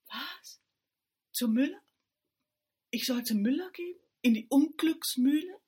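Murmeltier, shocked, asks about the mill; shocked question. A narrator's reading. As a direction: gasp it out in disbelief. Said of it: "fragte Murmeltier".

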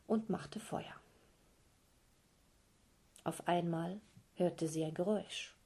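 plain reading voice

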